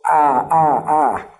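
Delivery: repeated open "ah", held evenly; open "ah" sound. Shown as a demonstration. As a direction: demonstrate a long, open "ah". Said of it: "this "ah" sound".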